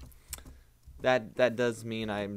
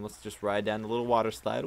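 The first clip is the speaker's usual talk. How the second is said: normal talking voice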